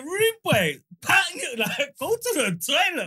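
high mock shout. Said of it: "man said".

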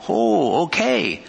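usual speaking voice

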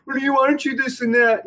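high, taunting imitation; mocking trash talk. Player imitating his opponent's shit talking, mocking high-pitched jeer. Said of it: "it was all shit talking".

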